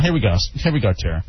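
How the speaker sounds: ordinary delivery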